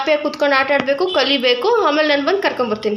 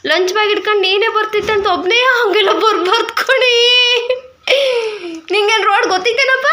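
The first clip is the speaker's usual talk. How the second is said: higher-pitched scolding breaking into laughter; amused chiding; 6 semitones higher than usual. Real, not acted. Mother telling child not to walk home alone, amused chiding.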